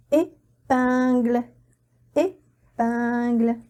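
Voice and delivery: "épingle" said very slowly, syllable by syllable; slow model word. Shown as a demonstration. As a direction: stretch "épingle" out for the class to copy. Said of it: "on va le lire pain". ("épingle" prononcé très lentement, syllabe par syllabe)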